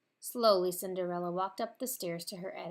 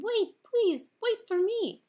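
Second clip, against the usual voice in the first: much higher, louder call down the stairs; 14 semitones higher, 11 dB louder, slower than usual. A narrator's reading. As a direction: call after them as she runs.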